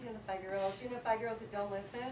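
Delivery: usual speaking voice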